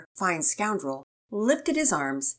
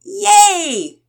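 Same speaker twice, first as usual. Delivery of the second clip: high, loud cheer; drawn-out, high-pitched hooray; excited yell; 13 semitones higher, 14 dB louder than usual. A narrator's reading. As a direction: yell it like two thrilled kids. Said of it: "Pearson and Penelope yelled".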